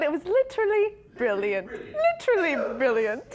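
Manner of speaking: high, amused mock British; playful British accent. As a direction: gush in a put-on British accent. Imitating the praised English accent.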